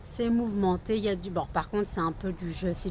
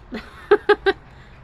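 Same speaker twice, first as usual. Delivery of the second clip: sudden squeal of laughter; high squealing laugh; 12 semitones higher, 12 dB louder than usual. Real, not acted. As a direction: burst into a shrill giggle. Dog climbs on her.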